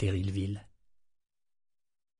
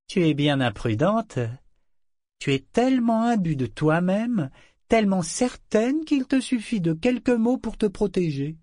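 higher taunting governor voice; lightly voiced cruel governor; mocking governor; 9 semitones higher than usual. A narrator's reading. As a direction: sneer at her pride.

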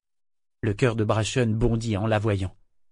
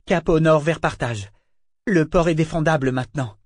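Althea, urgent; 5 semitones higher than usual. A narrator's reading. Althea explains the plan.

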